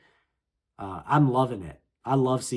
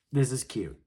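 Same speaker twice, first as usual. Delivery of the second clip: normal talking voice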